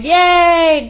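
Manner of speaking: high loud cheer; a happy crowd's yay. Acted. Crowd of prairie dogs, cheering yay. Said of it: "the crowd cheered".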